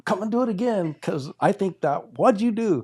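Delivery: high, loud, worked-up challenge; getting mad. Retold or imitated. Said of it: "like, getting mad".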